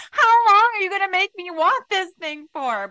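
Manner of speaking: high, loud, whining complaint; whiny tantrum. Retold or imitated. Complaining to God in a tantrum, high whiny wail. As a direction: whine at God like a sulking kid. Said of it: "threw a little tantrum".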